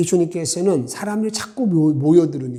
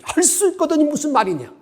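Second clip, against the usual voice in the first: much higher, a sharp shouted challenge; shouted rebuke; 12 semitones higher than usual. Retold or imitated. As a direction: yell it at him like Jesus rebuking. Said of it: "완전 소리를 지르는 거죠". (훨씬 높은 소리로 호통치듯 외치며)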